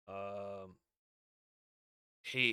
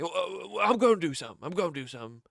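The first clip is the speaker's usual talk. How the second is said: higher, louder fired-up comeback; mock eager wrestler promising action; fired-up retort; 6 semitones higher, 7 dB louder, much faster than usual. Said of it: "Jay's like".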